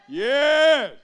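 a roaring excited yes; excited shout. Real, not acted. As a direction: shout it out with joy. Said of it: "Say it like you're excited about it".

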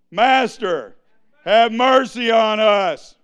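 loud voice of people crying out; hollering. Retold or imitated. Preacher voicing those who begged Jesus, loud hollering. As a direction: call out loudly for mercy.

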